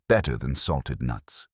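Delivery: ordinary delivery, nothing marked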